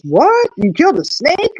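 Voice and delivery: high mock disbelief; husband hearing she killed a snake, lightly voiced shock; husband astonished. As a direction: play the stunned husband, voice jumping up. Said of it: "He goes".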